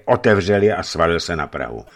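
usual speaking voice

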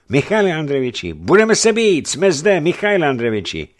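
loud, high, urgent calls; rallying shout. A narrator's reading. Comrades offering to fight for their leader, shouting. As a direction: call out to your leader, ready to fight.

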